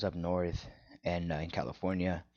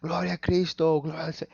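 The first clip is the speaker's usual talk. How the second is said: high-pitched mock congregation cheer; excited retold church shout; church going wild; 7 semitones higher, 4 dB louder than usual. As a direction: imitate an elated crowd praising. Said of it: "all the church went wild".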